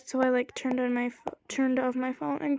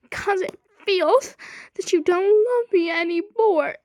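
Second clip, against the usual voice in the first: high, louder needy complaint; mock hurt imitation; 7 semitones higher, 5 dB louder than usual. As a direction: imitate him pleading, overdone and higher. Imitates his needy reply.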